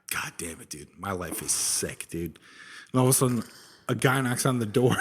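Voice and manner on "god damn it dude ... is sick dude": groggy mutter. Acted. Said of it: "He's like".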